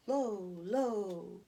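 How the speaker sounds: hollow echo from down a well; eerie echo. Acted. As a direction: let it ring back like a well echo. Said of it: "we see an echo down the well".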